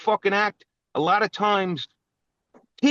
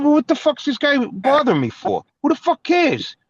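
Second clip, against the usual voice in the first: retold outburst; 6 semitones higher than usual. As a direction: fire it back at him, riled up. Retelling a row with cyclist.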